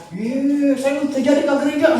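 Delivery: high sulky huff with a laugh; offended churchgoer. Retold or imitated. Churchgoer whose seat was taken, mock sulky huff.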